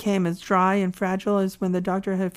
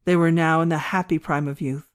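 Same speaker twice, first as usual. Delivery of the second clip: ordinary delivery, nothing marked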